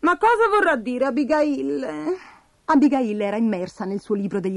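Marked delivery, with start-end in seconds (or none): 0.0-2.2 higher, slow puzzled question; lightly voiced baffled character; perplexed Bastoncello. A narrator's reading. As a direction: draw out the question, pitched up. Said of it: "Bastoncello era perplesso".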